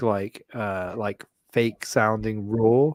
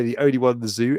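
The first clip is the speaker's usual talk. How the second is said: ordinary talk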